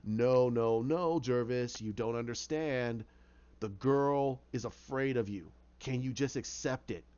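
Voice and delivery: low, slow, domineering inner voice; voice in his head. Acted. Inner voice telling Jervis she fears him, low. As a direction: press it home, low and unhurried. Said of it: "hear the voice in his head".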